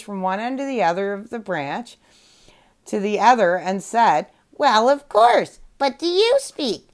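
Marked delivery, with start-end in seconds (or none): 4.6-6.9 high squawky parrot echo. Acted. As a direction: parrot parroting the panda, pitched up. Parrot repeats panda's words.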